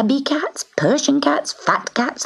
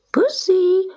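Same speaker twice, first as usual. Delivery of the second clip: calling voice; 14 semitones higher than usual. Acted. Old man calling the cat.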